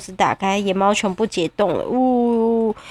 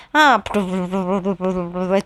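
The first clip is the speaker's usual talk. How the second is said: bubbling noises; 4 semitones lower, faster than usual. Acted. 猫钻到冰块下面.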